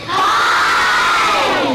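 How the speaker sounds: high shouted puppet hi; puppet yell. Acted. Dummy shouts hi to the kids, high puppet yell. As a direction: belt it out in the dummy's voice. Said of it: "Hey, you don't have to yell".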